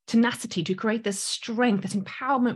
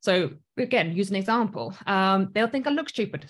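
ordinary talk

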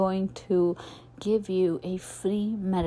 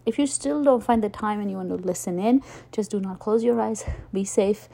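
usual speaking voice

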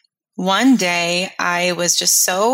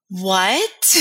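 stunned; 5 semitones higher than usual. Retold or imitated. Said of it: "my mouth dropped open ... like".

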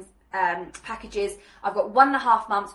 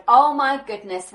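higher, louder, drawn-out gush; gushing testimonial; 3 semitones higher, 7 dB louder, slower than usual. Acted. Client raving about the coach on a podcast, gushing. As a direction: gush slowly like a thrilled client.

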